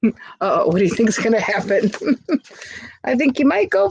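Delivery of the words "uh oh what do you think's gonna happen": ordinary delivery, nothing marked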